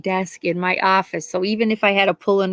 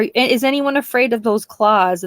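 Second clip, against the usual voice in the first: normal talking voice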